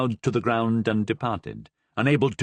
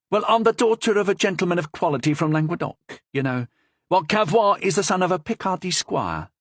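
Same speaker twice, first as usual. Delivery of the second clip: put-on lively young woman, higher, with a Languedoc accent; 6 semitones higher than usual. A narrator's reading. Madame Cavrois answers the Cardinal boldly, Languedoc accent. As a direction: bold Languedoc lilt, nose in the air. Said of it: "with a strong Languedoc accent".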